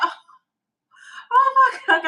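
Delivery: loud, very high panicked cry; acting out a burst of panic; hammed-up shriek of alarm; freaking out. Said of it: "I started freaking out".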